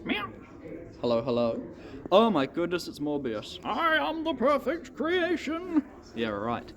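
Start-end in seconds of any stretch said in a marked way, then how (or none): 3.6-5.8 villain voice. Pretend. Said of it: "That was bad".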